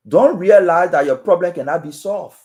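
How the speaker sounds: ordinary talk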